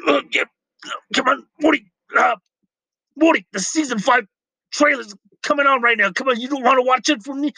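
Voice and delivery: put-on high, slurred drunk scientist voice; burpy drunk grandpa rant; Rick Sanchez impression. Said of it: "let me try to do it".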